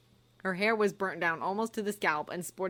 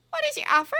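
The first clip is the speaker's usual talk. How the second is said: high tight question, louder; much higher, forced politeness; stiff; 8 dB louder, faster than usual. Acted. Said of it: "said Umbridge stiffly".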